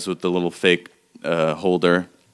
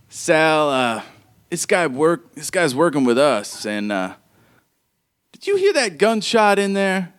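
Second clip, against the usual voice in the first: npc Sugar voice; 8 semitones higher than usual. Acted. Sugar NPC speaks to Sal.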